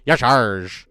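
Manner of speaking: hammed-up pirate growl on Y'Shaarj. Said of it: "What is wrong with me?".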